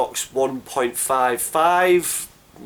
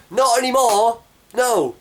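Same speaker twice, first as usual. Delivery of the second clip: emphatic rebuttal; 10 semitones higher, 5 dB louder, slower than usual. Real, not acted. Emphatic rebuttal of the quoted claim.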